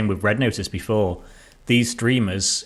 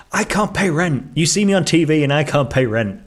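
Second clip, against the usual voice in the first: quoting a complaining actress; 6 semitones higher, 4 dB louder than usual. Retold or imitated.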